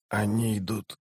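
softly voiced wounded man; dying mage. A narrator's reading. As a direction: barely give it any volume, like someone dying. (тихий голос умирающего)

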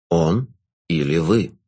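low, slow, velvety jester; sinister jester. Acted.

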